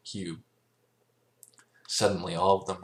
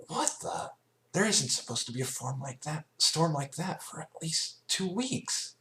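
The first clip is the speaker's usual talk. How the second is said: much higher, breathy what the, then puzzled; startled Rainbow; 10 semitones higher than usual. A narrator's reading. Rainbow Dash spotting an unexpected storm, startled. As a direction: caught off guard by a storm.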